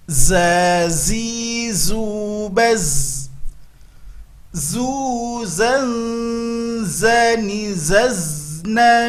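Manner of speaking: slow Quran recitation drill. Shown as a demonstration. Teacher leading students in a slow drill. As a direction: chant each syllable slowly and clearly.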